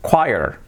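choir as KWAI-er, falling; pronunciación correcta. Shown as a demonstration. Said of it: "Pero así se dice". (choir como 'kuáier')

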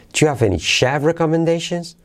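"chef" as the high peak, staying up; native question tune. Shown as a demonstration. Teacher echoing natives, high question peak on chef. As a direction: climb to "chef" as high as the hey. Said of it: "Así es como hablan los nativos".

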